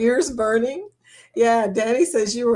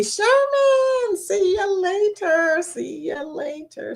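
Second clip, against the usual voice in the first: sing-song goodbye; 9 semitones higher, slower than usual. Real, not acted.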